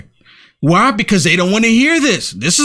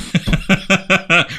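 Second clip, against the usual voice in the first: laugh. Real, not acted. Laughing at the mock voice.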